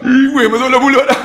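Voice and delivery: high, quick mock-distressed squeal; comic protest. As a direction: rush it in a high voice for laughs. Joking about snot while crying. (suara tinggi, cemas olok-olok)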